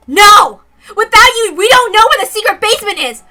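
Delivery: angry high shout; furious shout. Acted. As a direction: yell it in outrage, high and loud. Rena slapping Mion, demanding.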